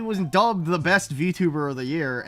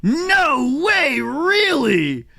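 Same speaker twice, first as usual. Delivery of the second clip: astonished; 11 semitones higher, 8 dB louder, much slower than usual. Real, not acted. Learning surprising streamer fact.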